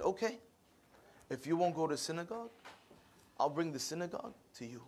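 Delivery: soft, measured answer from the father; quiet paternal resolve. Retold or imitated. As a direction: say it softly, letting it sink in. Dead father calmly answers in dream.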